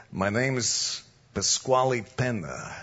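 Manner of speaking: low East Coast wiseguy voice; mafia tough guy. Retold or imitated. Mobster greets the preacher after church, low wiseguy voice. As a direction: drop low like a mob heavy. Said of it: "Just like this".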